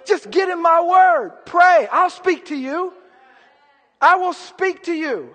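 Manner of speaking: loud, emphatic command from on high; God. Acted. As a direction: call it out slowly and forcefully. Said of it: "God said".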